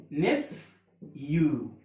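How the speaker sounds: drawn-out نيفيو with short e and long final "yoo"; نطق تعليمي مقطّع. Shown as a demonstration. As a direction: say نيفيو slowly, split as "nef... yoo". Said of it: "شورت إي، ن، والبي اتش بتعمل فا". (نطق نيفيو ببطء شديد، مقطعًا مقطعًا)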